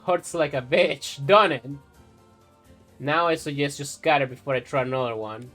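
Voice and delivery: put-on a loud, furious old woman's threat; thick with rage. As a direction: snarl it out at the children, seething. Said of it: "in a voice thick with rage".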